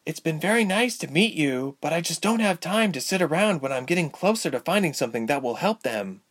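put-on high girlish voice; impatient young Ilse. A narrator's reading. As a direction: politely insist on leaving.

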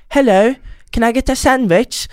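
high, put-on British accent; mock a Norwegian faking London English; pretentious fake British voice. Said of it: "finner seg en aksang, som er sånn".

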